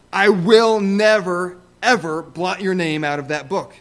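Jesus promising, stressing never; emphatic never. Acted. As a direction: lean hard into never and ever. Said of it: "Jesus Christ wants to emphasize".

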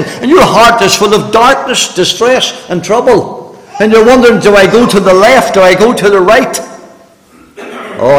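fervent preaching. Real, not acted. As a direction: drive it home to the congregation. Full-force preaching.

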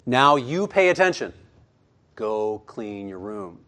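lightly voiced parent scolding a child, slowing at the end; talking to the kids about their messy room, mildly stern. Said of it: "We might say to our kids".

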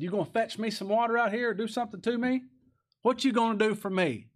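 high, demanding general's voice; indignant Naaman. Retold or imitated. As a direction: play the proud general who wants a show.

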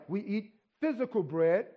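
ordinary talk, nothing marked

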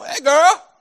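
put-on "girl" said as "gurl", high and loud with a rise; [name]'s "gurl". [name] greeting a girl, "girl" as "gurl", high and loud. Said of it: "it was G U R L".

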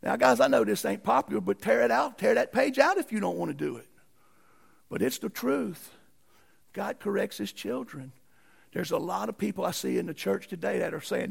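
ordinary delivery

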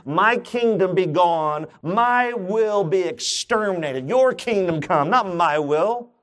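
slow, surrendered prayer; fervent new prayer. Pretend. Preacher to church, voicing a fervent surrender prayer. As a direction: pray it like giving everything up. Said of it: "here's our new prayer".

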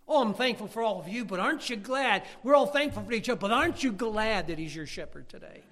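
loud, high appeal to the church; fervent preaching. Acted. Preacher asking the church if they are glad, loud and high. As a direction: press the question home.

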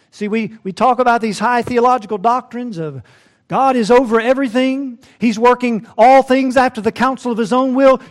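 high, loud doctrinal declaiming; loud, sustained preacher's recitation. Real, not acted. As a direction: proclaim it up high from the pulpit. Rattles off doctrines in raised voice.

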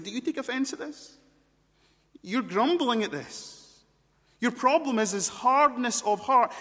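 high, rising challenge growing loud; challenging confrontation. Acted. Jesus confronting grumbling disciples, impassioned challenge.

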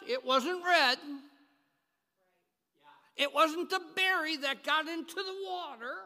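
loud, ringing sermon climax, slowing on the big lines; preaching at full force. Acted. Preacher to his congregation, proclaiming miracles at full force. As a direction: thunder it out to the congregation. Retelling Jesus's and God's miracles.

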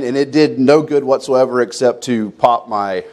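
usual speaking voice

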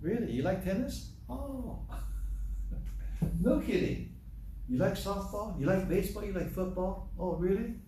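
high, wide-eyed questions; light up at every hobby they name; overdone delighted interest; eager excitement. Retold or imitated. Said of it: "my eyes get big".

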